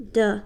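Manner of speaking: d held longer and punched; a long, emphatic d; stressed D sound. Shown as a demonstration. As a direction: make the d last. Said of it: "really good emphasis on the d".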